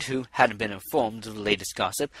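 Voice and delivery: plain reading voice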